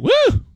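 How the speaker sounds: mock cheer. Host imitates the cheering in the school board audio. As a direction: let out a quick whoop like the crowd audio. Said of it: "And the audio was like".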